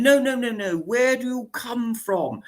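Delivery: mock aristocrat refusing the answer, insisting; hammed-up posh lady demanding again; insistent posh lady. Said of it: "And then".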